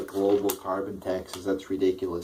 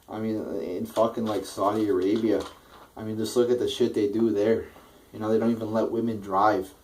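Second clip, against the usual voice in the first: ordinary talk, nothing marked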